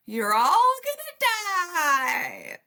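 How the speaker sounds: high, slow cackling witch; drawn-out spooky witch warning. Retold or imitated. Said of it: "in my best witch voice".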